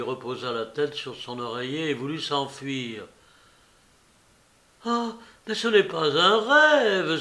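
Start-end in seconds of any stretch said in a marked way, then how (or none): 4.8-7.2 higher, louder cry of amazement; exclaiming. A narrator's reading. Patient seeing Georgette is no dream, exclaiming. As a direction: cry out as the dream proves real. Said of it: "s'écria-t-il".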